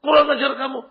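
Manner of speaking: a hint of an insulted man's outburst; mock anger. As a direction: snap back, lightly acted as offended. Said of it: "marah dia".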